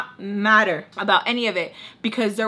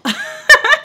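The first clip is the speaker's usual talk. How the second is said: short, high, loud laugh; high burst of laughter; 10 dB louder than usual. Real, not acted. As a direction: let out a sudden high laugh. Laughs at guardian angel line.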